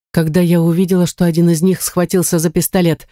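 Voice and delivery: usual speaking voice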